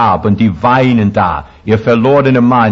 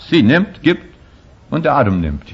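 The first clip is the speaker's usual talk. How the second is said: usual speaking voice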